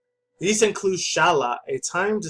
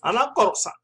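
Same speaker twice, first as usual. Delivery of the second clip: higher, jokey mock Japanese accent; 7 semitones higher than usual. Vlogger repeats his joke in a mock Japanese accent. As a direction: toss off "Namco-san" in a mock Japanese accent. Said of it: "when I do their little accent".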